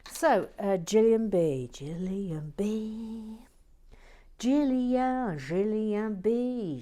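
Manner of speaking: loud, drawn-out call of the name; grand announcement. Pretend. Calling out a viewer's name loudly, drawn out. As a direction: announce her like a star.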